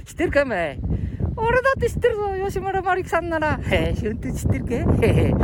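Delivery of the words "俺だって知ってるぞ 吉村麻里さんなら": very high puppet boast; high, cheeky character voice; boasting puppet sidekick. Pretend. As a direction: insist as the squeaky little dummy.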